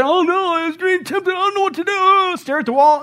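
high, whiny panicked voice; mock the helpless believer. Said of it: "God doesn't expect you to say".

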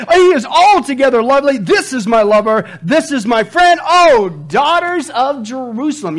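loud, high declamation; hammed-up rapturous finale; impassioned scripture reading. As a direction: proclaim the beloved with rising fervor. Acts out the woman's love poem.

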